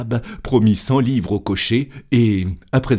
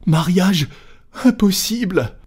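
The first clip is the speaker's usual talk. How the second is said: high-pitched flustered outburst; frantic valet voice; agitated servant; 10 semitones higher than usual. Acted. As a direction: blurt each word in a high panic. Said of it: "balbutia Passepartout".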